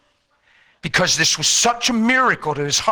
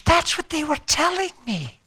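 wife's voice; 12 semitones higher than usual. Retold or imitated.

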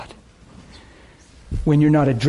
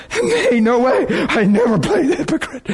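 bawling; 8 semitones higher than usual. Retold or imitated. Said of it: "bawling, and going".

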